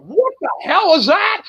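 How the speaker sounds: high-pitched mock disbelief; crowd's shocked outcry. Storyteller imitating a crowd after his bawdy promo, high mock shock. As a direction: imitate a whole crowd's stunned reaction. Acts out stunned crowd's reaction.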